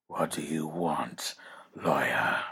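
low, slow whispered snarl; Batman's menacing rasp. Acted. Batman growls down at the lawyer in a low whispered snarl. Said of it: "snarled Batman".

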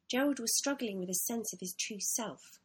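ordinary delivery

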